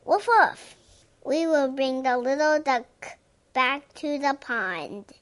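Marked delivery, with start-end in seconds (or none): 0.1-0.8 high yappy woof woof; playful dog bark. Pretend. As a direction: bark like an eager puppy. Dog barking in picture book.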